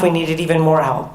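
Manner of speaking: ordinary talk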